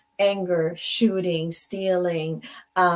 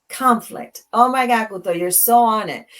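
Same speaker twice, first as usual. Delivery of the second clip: excited exclamation; 3 semitones higher, 7 dB louder than usual. Real, not acted. Host reacts loudly, thrilled by a viewer's comment. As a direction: cry out in delight at the comment.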